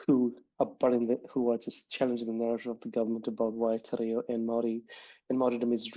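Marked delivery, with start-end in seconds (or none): none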